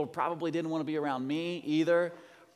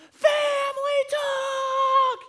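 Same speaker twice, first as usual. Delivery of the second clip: screamed much higher and louder, drawn out; shouted call; 8 dB louder, much slower than usual. Shown as a demonstration. Dad showing how the family talk is called, full yell. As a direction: bellow it up the stairs to summon everyone. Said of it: "You just scream at the top of your lungs".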